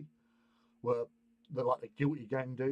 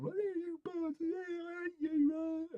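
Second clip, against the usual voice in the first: high squeaky mocking voice, much higher; mock taunt; slower than usual. Imitating the bully shouting at girls, squeaky high mock. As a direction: squeak it out like a cartoon kid. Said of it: "He's gone".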